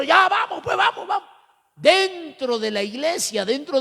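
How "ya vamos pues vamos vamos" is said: high, heated call to step outside; mock challenge to fight. The youth daring the church leader to fight outside, high and heated. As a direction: dare him to come outside and fight.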